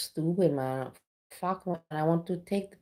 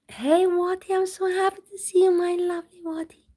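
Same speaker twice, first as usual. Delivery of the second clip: gushing greeting; 12 semitones higher, 4 dB louder than usual. Real, not acted. Greeting her friend Wati on stream, much higher and gushing. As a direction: squeal with delight at a loved one.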